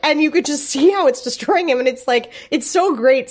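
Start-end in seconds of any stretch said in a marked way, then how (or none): none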